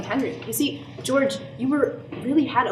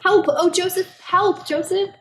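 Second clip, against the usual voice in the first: high, loud terrified cry; desperate shrieks for help; screaming for help; 9 semitones higher, 3 dB louder, slower than usual. Acted. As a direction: scream for rescue in panic.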